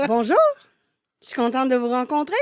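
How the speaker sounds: sugary fake-friendly hello; fake polite greeting. Shown as a demonstration. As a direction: greet her with your best fake smile. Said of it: "avec mon plus beau faux sourire".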